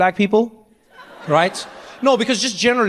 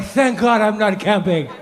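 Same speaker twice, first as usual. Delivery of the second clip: relieved mock prayer; slower than usual. His morning relief.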